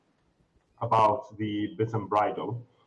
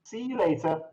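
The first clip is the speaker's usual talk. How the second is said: put-on higher, sweet old lady goodbye; shop lady farewell; 6 semitones higher than usual. Shop lady saying goodbye to the customer, higher and sweet. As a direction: send them off kindly.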